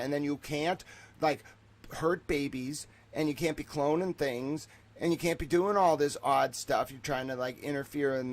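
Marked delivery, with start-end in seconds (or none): none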